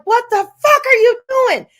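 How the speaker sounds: high, loud retold outrage; pissed-off outburst. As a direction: act out scolding a friend, exasperated. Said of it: "I was so fucking pissed".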